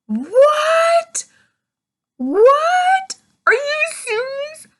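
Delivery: high, loud disbelieving shout; shocked shout. Retold or imitated. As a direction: yell it in total disbelief. Shocked reaction to book twist.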